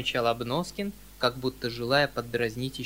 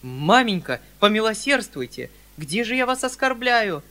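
high, louder pleading; begging son; 7 semitones higher, 8 dB louder than usual. Acted. Uncle begs his mother for mercy, high and pleading. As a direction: appeal for mercy.

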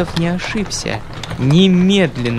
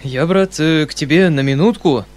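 hurried; 2 semitones higher than usual. Acted. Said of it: "начал он торопливо".

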